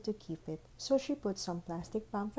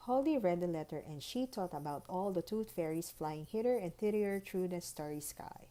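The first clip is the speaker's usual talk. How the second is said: ordinary delivery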